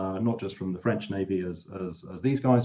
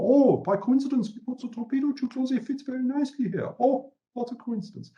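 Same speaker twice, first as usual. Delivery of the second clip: much higher, faux-surprised sing-song; mock innocent surprise. Germans pretending the torpedo tubes fit by chance, mock surprised. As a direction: act wide-eyed innocence nobody believes.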